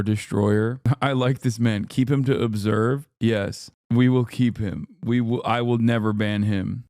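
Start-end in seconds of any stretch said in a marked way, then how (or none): none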